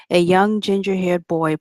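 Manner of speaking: usual speaking voice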